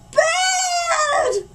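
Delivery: the word bursts into a very high shout; shocked shriek. Acted. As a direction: shriek it in horror. Mom shrieks seeing the hair.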